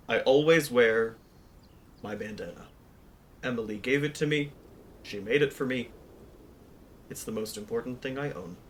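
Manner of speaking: understated and earnest, trailing into softness. Acted. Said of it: "his canon character voice".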